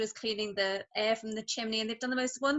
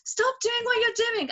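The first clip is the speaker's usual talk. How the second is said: put-on higher, louder little girl begging; eager child; 10 semitones higher, 5 dB louder than usual. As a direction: imitate a kid pestering mom to play now. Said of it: "Alice runs around the house ... saying".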